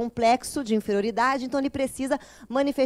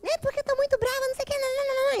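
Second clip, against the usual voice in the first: mock-angry girl, much higher and fast; squeaky mock outrage of an offended girl; imitating an angry girl; 13 semitones higher, much faster than usual. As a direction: ham up the huffy young woman's complaint. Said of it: "ela chegou muito brava e falou assim".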